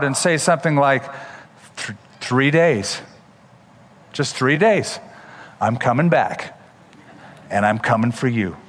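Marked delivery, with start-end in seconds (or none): 1.8-8.6 a hint of movie-villain threat; mock threat. Imagined Jesus warning the crowd, lightly menacing and low.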